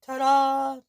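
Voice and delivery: high, loud presenting fanfare; proud ta-da. Acted. Girl showing Maxim her fish pie, loud and high. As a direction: unveil the dish with a shout.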